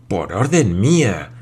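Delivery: slow, swelling disbelief on both stressed words; incredulous exclamation. A narrator's reading. Glenarvan told the orders were his, incredulous. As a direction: echo it slowly, astonished. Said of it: "exclamó Glenarvan".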